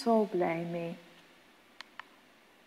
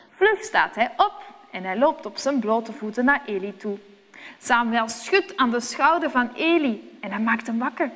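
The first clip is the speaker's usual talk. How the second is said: ordinary delivery, nothing marked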